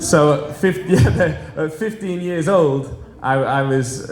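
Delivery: amused, laughing as he recalls it; laughing while talking. Real, not acted. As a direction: chuckle through the words at the memory. Laughing while recalling his stand-in show.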